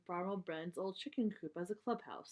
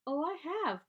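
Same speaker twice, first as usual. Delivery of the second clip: protesting; 9 semitones higher, 4 dB louder than usual. Acted. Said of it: "protested Sister".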